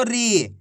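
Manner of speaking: री held long, then dropping; drawn-out long ī. Shown as a demonstration. Teacher reading syllables for class to copy, drawn out. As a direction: stretch out री. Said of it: "खींच के पढ़ेंगे".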